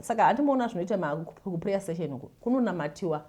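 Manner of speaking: ordinary talk, nothing marked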